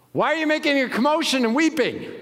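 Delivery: high, loud puzzled question; incredulous Jesus. Acted. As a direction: ask the mourners why they weep, surprised. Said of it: "he said to them".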